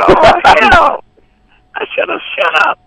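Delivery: high put-on female voice; retold woman's voice. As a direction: mimic her exasperation in a high voice. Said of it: "she said".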